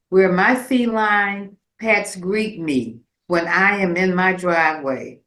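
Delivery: usual speaking voice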